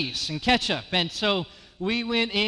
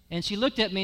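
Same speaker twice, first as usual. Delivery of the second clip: usual speaking voice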